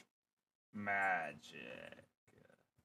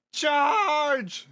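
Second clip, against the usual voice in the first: loud charging yell; battle cry; 11 semitones higher, 10 dB louder than usual. Acted. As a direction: roar into battle.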